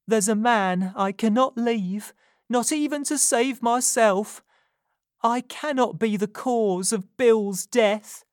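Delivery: urgent Nancy. A narrator's reading. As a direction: confess it as if your life depends on it. Nancy resolute.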